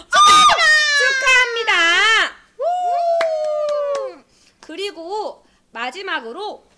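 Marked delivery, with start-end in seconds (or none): 0.0-4.2 loud high congratulations; excited cheer. Real, not acted. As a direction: cheer the winner. Final winner cheered.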